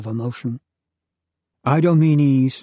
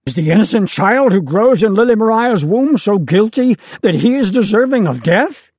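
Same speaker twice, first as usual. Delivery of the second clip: much higher and louder anguished shout; 9 semitones higher, 7 dB louder than usual. A narrator's reading. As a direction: scream the question at the angel. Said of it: "Idomenes shouts again".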